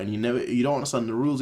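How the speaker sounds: ordinary talk, nothing marked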